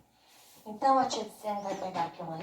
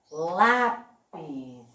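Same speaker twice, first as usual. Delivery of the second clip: "Lápis" split in two, LÁ strong, pis diminished; stressed first syllable; 5 semitones lower than usual. Shown as a demonstration. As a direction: stretch "Lápis", dropping away on pis. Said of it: "o pis eu diminuí um pouco".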